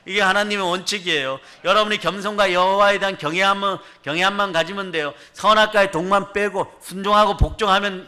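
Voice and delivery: loud, high preaching to the flock; impassioned Korean sermon climax; fiery preaching. Real, not acted. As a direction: rouse the congregation, full force. Preaching at full force to congregation.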